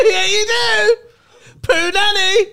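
high, slow cackling tease; laughing gloat. Real, not acted. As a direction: gloat while laughing hard. Gloating over his pun.

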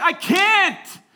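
high, loud shouted reply; mock helpless yell. Pastor refusing the desperate teen, mock helpless yell. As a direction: yell back just as hard.

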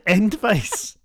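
"advice" drawn out as "endvice"; mimicked mispronunciation. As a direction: say "advice" slowly, with "end" at the front. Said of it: "Oh, you said advice?".

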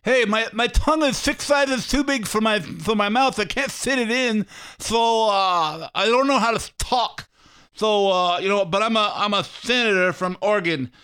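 high, lisping dog with a huge tongue; mocking senator as a big-tongued dog; talking dog voice. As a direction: voice the dog Tank struggling to talk. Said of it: "like listening to my dog Tank if he could talk".

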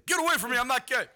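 very high, loud, panicked yell. Retold or imitated. Friend imagining Trey's panicked reaction, very high loud yell. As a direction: shriek it in alarm. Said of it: "he would have been instantly".

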